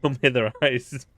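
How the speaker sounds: loud, high, cracking up; laughing. Real, not acted. Player reacting to alien's eyes, laughing. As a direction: blurt it out through giggles.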